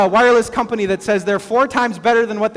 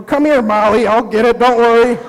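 mock helpful; 5 dB louder than usual. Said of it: "trying to take your contact out".

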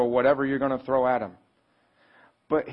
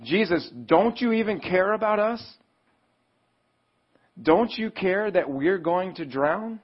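high-pitched pleading, imitating the disciples; acted desperation; 6 semitones higher than usual. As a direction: plead with him as if about to drown, acted. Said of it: "in desperation they were like".

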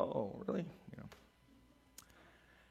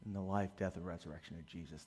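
normal talking voice

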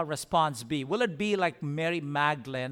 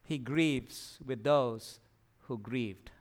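slow, low and soft; hushed sincere preacher's close; tender; 6 semitones lower, 6 dB quieter, slower than usual. Real, not acted. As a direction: let it sink in gently. Tender climax of sermon point.

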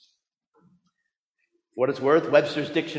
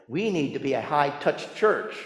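ordinary delivery

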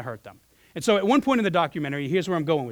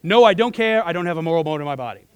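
quick, firm reply; 5 dB louder than usual. Retold or imitated. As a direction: fire back his answer without hesitation. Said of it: "that's how he answered that question".

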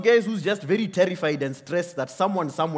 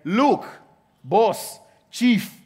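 louder, curt and disrespectful; rude dismissive address; 3 semitones higher, 5 dB louder, much slower than usual. Retold or imitated. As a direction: brush your father off like a servant. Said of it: "doesn't even call him father".

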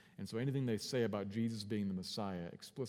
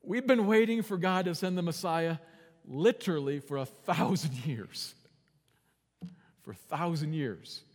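Peter complaining; 6 semitones higher, 8 dB louder than usual. Retold or imitated. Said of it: "Peter might say".

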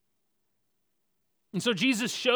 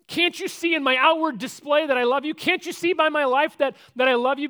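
high, loud, insistent appeal; loud protesting plea; 9 semitones higher, 9 dB louder than usual. Acted. Peter loudly justifying himself to Jesus, insistent protest. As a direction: plead your case at full voice. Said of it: "But he doesn't say".

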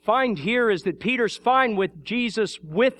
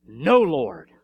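NO punched loud, then dropping; stressed NO; 4 semitones lower, 5 dB louder than usual. Retold or imitated. Peter refuses Jesus who came as servant, stress on No. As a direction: hit the word No hard. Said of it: "And the emphasis".

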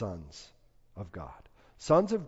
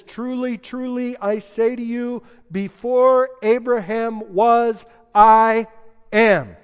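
high, loud, slowing to a climactic I AM; booming proclamation; 9 semitones higher, 11 dB louder than usual. Acted. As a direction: declare it like thunder from the pulpit. Jesus drops the mic.

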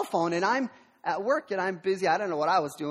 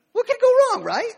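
high, loud, ironic "what could go wrong"; wide-eyed ironic question; mock innocent; 8 dB louder than usual. Real, not acted. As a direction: play innocent, knowing the disaster coming. Ironic aside to the congregation.

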